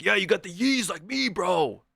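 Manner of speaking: a hint of an eager sneakerhead; hyped bro voice. Guy insists they match.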